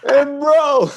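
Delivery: high, laughing bro; can barely get it out for laughing; wheezing through laughter. Real, not acted. Helpless laughter after the story.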